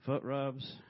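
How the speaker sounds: laughing through the words. Real, not acted. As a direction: chuckle it out. Laughing correction.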